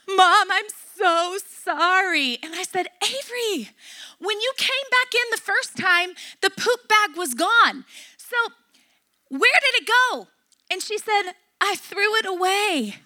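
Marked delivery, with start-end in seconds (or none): 0.1-2.4 imitating a weeping child, drawn out; higher, slow mock-tearful plea; crying apology. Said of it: "she was crying". 3.0-10.3 higher-pitched parent interrogation, retold; acted exasperated mom cross-examining; angry questioning. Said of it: "I was so angry". 11.6-13.0 imitating a crying child, higher and slow; mock-sobbing admission; tearful confession. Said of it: "she was crying".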